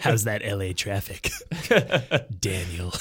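lightly voiced drive-time radio DJ. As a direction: slip into a drive-time DJ patter. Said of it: "kinda dipped into, like, drive time D J".

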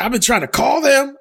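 very high, loud outraged dad; imitating dad angry. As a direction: squeal it up high like the fed-up dad. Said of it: "he's like".

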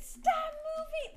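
high, slow shouted order; cop yelling. Retold or imitated. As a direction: bark it like a cop. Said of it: "The cop".

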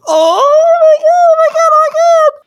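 high shrieking oh my God; cringing shriek. Retold or imitated. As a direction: shriek it in horror. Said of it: "you go".

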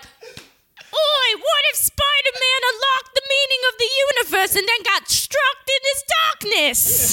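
high, hammed-up British accent; British tough. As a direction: overblown mock British tough guy, way up in pitch. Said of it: "a British tough sort of voice".

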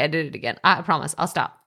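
ordinary talk

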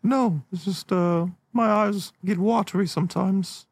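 high-pitched, bashful denial; small high storybook-bear voice; Winnie the Pooh. Acted. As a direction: deny the tears in a high little voice. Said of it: "I don't know why I sound like Winnie the Pooh now".

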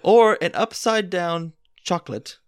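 hammed-up storyteller voice; playful theatrical narration; dramatic story reading. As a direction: read it like a grand dinner theater tale. Reads the Mad Lib story aloud.

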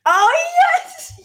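very high, loud, gleeful cry; thrilled squeal of recognition; excited yes. Real, not acted. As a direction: shriek with recognition and glee. Reacting to gravy line.

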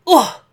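loud, sudden "ugh" jumping up in pitch; short grunt as if hitting the ground; impact grunt. A narrator's reading. Santa hits the bottom of the chimney, loud grunt.

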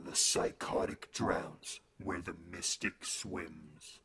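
low, slow ominous intoning; echoey ominous voice. Retold or imitated. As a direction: intone it slowly like a distant spirit. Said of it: "the echoey voices".

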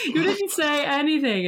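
high, laughing disbelief; Nadia laughing. Retold or imitated. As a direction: laugh through her confused question. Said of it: "she's like".

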